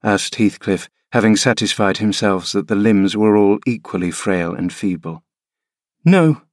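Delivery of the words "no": a high, small scared no; frightened no. Acted. Frightened boy answers his stern father, high scared no. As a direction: answer in a thin voice of fear. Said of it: "with a gaze of vacant fear".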